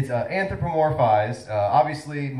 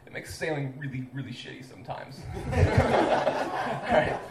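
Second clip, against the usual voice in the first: usual speaking voice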